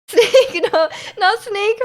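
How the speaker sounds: laughing out the word; giggling through it. Real, not acted. Laughs at own snake voice.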